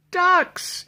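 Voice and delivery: a policeman shouting it down the phone; yelling. Acted. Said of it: "yelled Michael".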